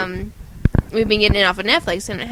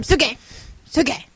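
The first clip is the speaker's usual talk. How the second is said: "Sue K" run together, higher and louder; said fast; 6 semitones higher, 3 dB louder than usual. Acted. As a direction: call out "Sue K" as one quick word. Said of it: "fast all the time".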